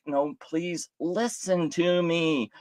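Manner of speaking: slow, drawn-out mock plea; mock pleading. Mocking someone begging to be heard, slow pleading voice. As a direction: plead slowly in a put-on voice. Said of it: "And it's like".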